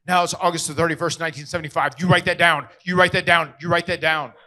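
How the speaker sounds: loud, firm retold order; acting out a forceful, insistent instruction; insistent correction. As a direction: drive it in loudly, phrase by phrase. Official firmly correcting the birth date.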